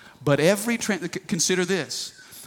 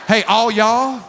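playful Southern drawl with a laugh, louder; hammed-up Southern greeting to the crowd; Southern accent; 4 semitones higher, 5 dB louder, slower than usual. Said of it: "He was Southern".

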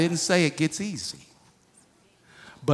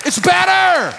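loud, much higher cry of "better"; preacher's shout; 12 semitones higher, 7 dB louder, slower than usual. Acted. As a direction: shout it to the back of the church.

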